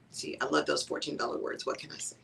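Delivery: unvoiced, hushed self-mocking aside; whispered aside. Real, not acted. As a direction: whisper it to yourself with a grin.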